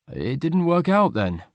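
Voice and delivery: slow, sinking question; disappointed. A narrator's reading. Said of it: "Will sounded disappointed".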